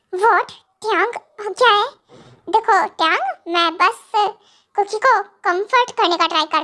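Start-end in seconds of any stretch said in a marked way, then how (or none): none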